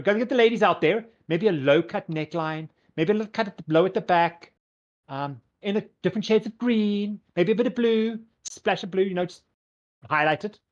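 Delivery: high, enthusiastic fashion designer; gushing, lilting diva selling a look; Fabulous. Acted. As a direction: rattle off a makeover vision with theatrical relish. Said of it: "Fabulous goes".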